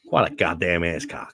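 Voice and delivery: hammed-up American impression; mock American accent. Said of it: "idea what your accent might be like".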